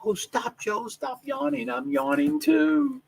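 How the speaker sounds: high teasing fan voice; teasing follower voice. Retold or imitated. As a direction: tease him like a follower. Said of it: "people would be telling me that".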